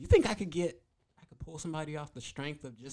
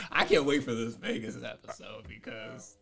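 ordinary delivery

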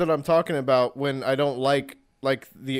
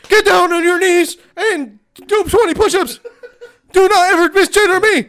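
drill sergeant; 10 dB louder than usual. Pretend. Mock drill sergeant yelling, laughing.

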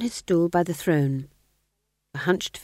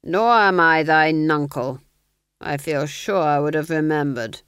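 louder, clipped aristocratic command; cold Duke; 6 dB louder than usual. Acted. Duke dismissing the sergeant: louder cold command. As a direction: dismiss him like a bored lord.

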